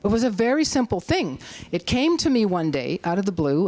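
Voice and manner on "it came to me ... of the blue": ordinary talk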